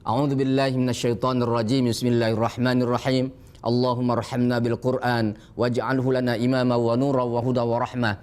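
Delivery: reverent recited prayer; closing prayer. Acted. Teacher closing the lesson with a recited prayer, reverent. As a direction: recite the closing supplication devoutly.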